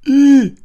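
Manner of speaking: very high, loud disgusted "ih"; empörter Ausruf, sehr hoch; outraged cry. A narrator's reading. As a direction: shriek in outrage. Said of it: "rief der Hund empört".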